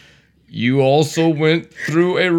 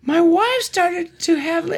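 high nervous driver voice; 11 semitones higher than usual. Acted. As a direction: squeak it out like a flustered driver. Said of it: "Do different voices".

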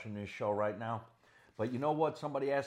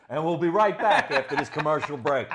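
loud, higher-pitched through laughter; laughing; 5 semitones higher, 6 dB louder than usual. Real, not acted. Host cracking up as he jokes about a break, laughing. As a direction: laugh your way through a mock commercial throw. Cracking up, jokingly cuts to commercial.

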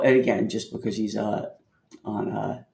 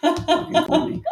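laughing high squeak; slower than usual. Pretend. Mock plea to Daryl.

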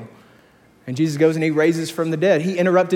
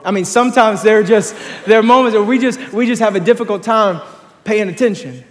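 louder, higher impassioned preaching; preaching at full force; 6 semitones higher, 6 dB louder than usual. Real, not acted. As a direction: lift the room with conviction.

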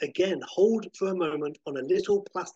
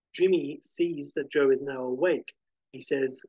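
ordinary talk

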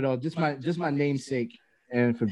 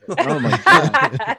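high, loud, slow laughing gasp of disbelief; 12 semitones higher, 8 dB louder, much slower than usual. Real, not acted. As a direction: drag it out in laughing shock. Reacting to deep dish preference.

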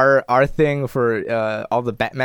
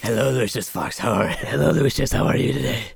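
raspy, growled everyday greeting; Bruce Wayne. Retold or imitated. Flipped-voice billionaire greeting Lucius Fox, raspy growl. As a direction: growl small talk like a masked vigilante. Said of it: "his voices are flipped".

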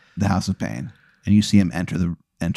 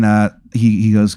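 ordinary talk, nothing marked

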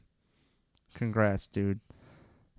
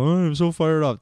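mock doucher; 4 semitones higher, 4 dB louder than usual. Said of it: "Typical doucher talking in the mic".

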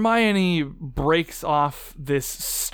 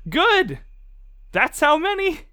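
mock cheer; 13 semitones higher, 5 dB louder than usual.

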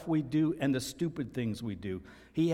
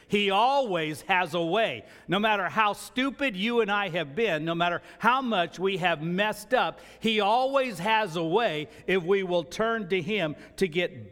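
louder, higher, hammering each line home; forceful preaching; 4 semitones higher, 3 dB louder than usual. Real, not acted. Pastor urging the congregation, loud and high. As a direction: preach it out with full conviction.

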